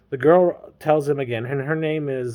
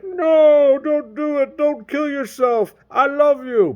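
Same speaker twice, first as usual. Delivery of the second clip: high, loud melodramatic wail; mock desperate plea; 13 semitones higher, 5 dB louder, slower than usual. James calls to Terry walking into water, mock desperate. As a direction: cry out in hammed-up desperation. Voices James pleading against drowning.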